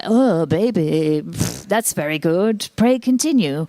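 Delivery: slow, plummy upper-class English purr; posh bedroom voice. Retold or imitated. Woman in her forties mimics her posh cries in bed, slow posh purr. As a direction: drawl it like an aristocrat in bed. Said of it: "it turns out I'm quite posh during sex".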